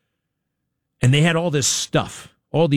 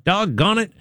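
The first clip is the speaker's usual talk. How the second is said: imitating a calm, mild man calling his dog, pitched higher; lightly voiced easygoing dog owner; calm, benign; 7 semitones higher than usual. Imitating the father calling the family dog, calm and higher. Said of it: "like, kinda calm and, like, benign".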